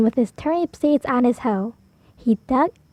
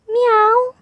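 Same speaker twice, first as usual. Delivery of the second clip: 1 cat meow; 9 semitones higher, 3 dB louder than usual. Acted. Cat after the fall.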